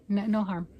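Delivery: ordinary talk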